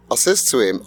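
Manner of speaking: ordinary talk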